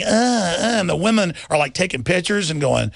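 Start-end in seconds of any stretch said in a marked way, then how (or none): 0.0-0.8 playful mock approving grunts; imitated leering grunts. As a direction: grunt along like a gawking crowd. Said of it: "they're just going".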